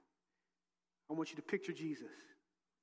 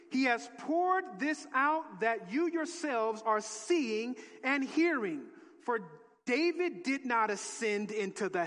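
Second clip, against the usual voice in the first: slow, loud proclaiming; emphatic scripture reading; 5 semitones higher, 7 dB louder, slower than usual. Acted. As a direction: proclaim it slowly, line by line. Reading Peter's sermon.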